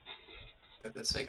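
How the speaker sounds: soft unvoiced whisper, slow. Real, not acted. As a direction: breathe it out quietly. Repeats line, whispered.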